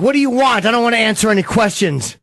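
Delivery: high, put-on irritated outburst; mock annoyed. Co-host role-playing a passerby to the guest, mock annoyed. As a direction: play at being fed up with the interviewer. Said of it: "let's just say I was annoyed".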